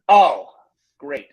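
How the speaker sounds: loud 'oh' in an imitation of a cross kid; put-out little girl, lightly acted; mock annoyed child. Grandfather quotes the girl mad that morning, mock annoyed. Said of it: "she got mad at me".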